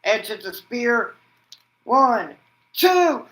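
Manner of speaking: high, loud ringside call rising on the count; excited play-by-play. Acted. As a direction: call the count like a hyped announcer. Calling a near fall.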